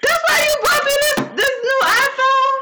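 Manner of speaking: high shrieking laughter through words; laugh-crying. Real, not acted. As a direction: squeal it out, half crying with laughter. Mock realizing, laughing-crying.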